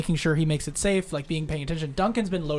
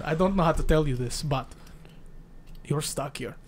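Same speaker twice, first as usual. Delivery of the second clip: put-on Russian accent, trailing softer; 3 dB quieter than usual. Adrian tells the travelers they are stuck, Russian accent. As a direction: break it reluctantly in a Russian accent.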